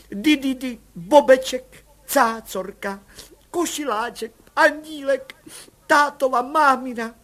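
high cooing list of pet names; young mother cooing baby names to the commissioner; doting mother. Acted. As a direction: coo each name like to a baby.

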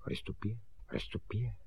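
very soft muttered repeat; hushed self-rehearsal; repeating to himself. Acted. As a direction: try the word out under your breath. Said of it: "Opakoval si Honzík".

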